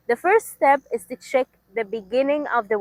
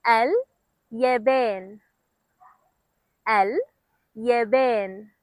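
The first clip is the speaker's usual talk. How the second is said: slowly; much slower than usual. Shown as a demonstration. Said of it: "one more".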